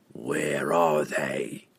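very slow, low, quiet growl; impatient growl. A narrator's reading. Assassin questioning the captive pirate, impatient low growl. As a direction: draw it out low, patience worn away. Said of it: "the assassin growled, his patience worn away".

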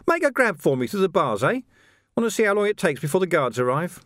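cheerily bait him; cheerful taunt. Acted. Carcer baiting Vimes through the bars, cheerfully mocking.

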